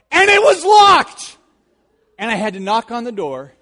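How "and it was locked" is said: high, loud, slow shocked cry; much higher and louder, dragged out in disbelief; shocked discovery. Retold or imitated. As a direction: land the twist with outrage. Boy finds door locked.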